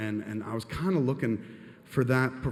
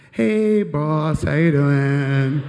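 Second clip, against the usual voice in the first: loud, high, drawn-out singsong hail; hammed-up, stretched-out greeting; greeting the boss after summoning courage; 4 semitones higher, 10 dB louder, much slower than usual. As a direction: sing out an overeager hello to the boss. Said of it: "worked up my courage and was like".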